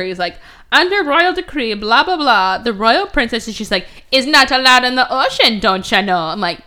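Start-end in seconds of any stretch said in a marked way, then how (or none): 0.7-3.5 higher, louder mock-official recital; hammed-up reading of royal rules; Sebastian quoting rules. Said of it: "quoting the rules at her". 4.1-6.2 louder, put-on Jamaican accent; teasing imitation of a Jamaican lilt; mock Jamaican accent. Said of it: "You're being racist".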